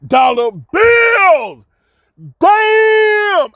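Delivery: loud, high shout of disbelief; stunned shout. Retold or imitated. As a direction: blurt it out in shock at the cash. Shocked at three hundred dollars.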